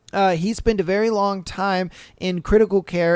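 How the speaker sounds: ordinary talk, nothing marked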